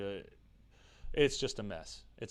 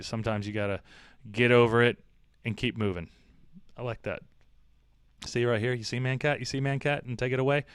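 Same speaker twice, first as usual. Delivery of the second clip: usual speaking voice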